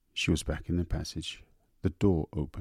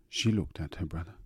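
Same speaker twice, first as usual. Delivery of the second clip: ordinary delivery, nothing marked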